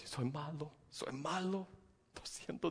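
soft, whimpering self-reproach; mock self-pity. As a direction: beat yourself up quietly. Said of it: "sentimos que tenemos que estar latigándonos". (autorreproche quejumbroso y suave)

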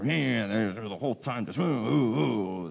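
put-on child's whine sliding into wails; mock whiny crying. Kids told to do something, mock crying. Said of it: "start crying".

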